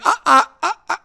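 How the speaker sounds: high, halting "uh" noises; shell-shocked stammer. Retold or imitated. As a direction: imitate stunned people lost for words. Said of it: "were a little bit shell shocked".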